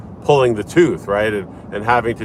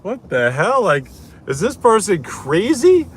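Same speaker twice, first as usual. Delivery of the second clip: higher, incredulous inner voice; mock disbelief, pitched up; wife silently reacting to a patient, incredulous and higher; 9 semitones higher than usual. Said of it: "my wife's thinking to herself".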